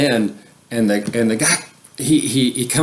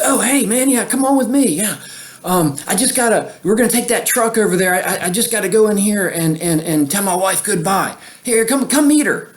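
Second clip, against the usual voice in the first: imitating an eager guy, pitched much higher; eager helpful stranger; 9 semitones higher than usual. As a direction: play the friendly guy rushing you along, voice up high. Guy offering help, voiced eagerly.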